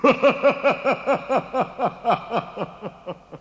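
high snickering laugh; giant snicker. Acted. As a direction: cackle off as the giant. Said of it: "s'en alla en ricanant".

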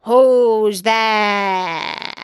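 loud, drawn-out wolf call; big wolf voice. A narrator's reading. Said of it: "hearing the big voice of the wolf".

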